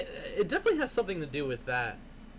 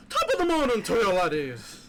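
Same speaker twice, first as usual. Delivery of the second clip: thick Irish brogue, higher and louder; leprechaun; 7 semitones higher, 9 dB louder than usual. Retold or imitated. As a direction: ham up a stage Irish leprechaun. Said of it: "put on the thick eye".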